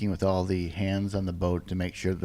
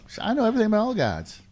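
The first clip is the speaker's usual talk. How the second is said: boastful; 10 semitones higher than usual. Acted. Boastful character claim.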